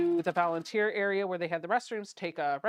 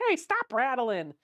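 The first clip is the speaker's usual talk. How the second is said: higher, drawn-out telling-off; playful scolding; 5 semitones higher than usual. Real, not acted. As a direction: scold a noisy machine, voice raised. Scolds her rattling machine.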